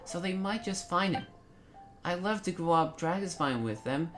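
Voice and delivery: put-on high, light little boy voice; child character Joel. Voices young Joel's game dialogue.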